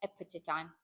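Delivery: ordinary delivery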